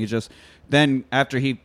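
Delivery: ordinary delivery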